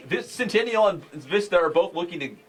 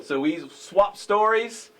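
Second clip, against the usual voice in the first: ordinary talk, nothing marked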